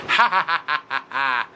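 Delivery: villainous stagy cackle; gloating villain's ha-ha-ha; evil laugh. A narrator's reading. Villain's evil laugh after wager.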